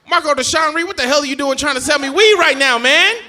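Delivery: loud, high-pitched telling-off; hammed-up outrage at a cousin; indignant scolding.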